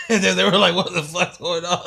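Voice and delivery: high, loud, cracking up; helpless amusement over the retelling; laughing while talking. Real, not acted. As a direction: barely get it out through real laughter.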